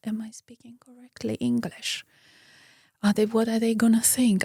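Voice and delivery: fast nervous whisper to herself; self-doubting inner whisper; anxious whisper. Retold or imitated. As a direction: whisper the worry quickly under your breath.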